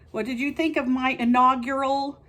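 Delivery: ordinary talk, nothing marked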